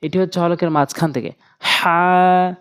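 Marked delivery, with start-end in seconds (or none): 1.6-2.5 হা pushed from mid-throat; হা said with throat pressure; pressed haa. Shown as a demonstration. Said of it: "চাপ দিয়ে উচ্চারণ করতে হবে".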